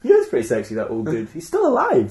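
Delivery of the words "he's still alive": high, laughing exclamation; a sudden high burst of delight; amused surprise. Real, not acted. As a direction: squeal out the surprise. Laughing surprise at old actor.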